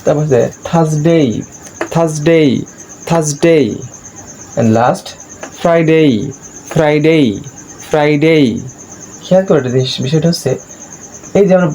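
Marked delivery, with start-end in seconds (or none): 0.7-3.7 Thursday as "thas-dei", R silent; model pronunciation. Shown as a demonstration. Teacher modelling Thursday for learners, model pronunciation. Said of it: "ই যুক্ত করে". 5.6-8.4 Friday with a clear "dei" glide; model pronunciation. Shown as a demonstration. Teacher modelling Friday for learners, model pronunciation. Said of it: "ই যুক্ত করে".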